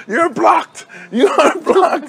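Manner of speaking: mock blocking. Coach blocking kids like online.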